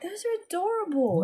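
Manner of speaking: put-on high, gushing little girl. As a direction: coo like a small girl at a toy. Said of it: "they're like".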